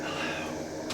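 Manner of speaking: unvoiced, very soft hello; ghostly whisper. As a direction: breathe it like a woman's ghost. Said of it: "they hear a woman go".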